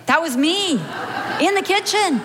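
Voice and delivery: usual speaking voice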